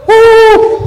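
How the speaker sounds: high, loud exultant whoop; joyful whoop. Real, not acted. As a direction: let out a jubilant shout. Excited preacher exclamation.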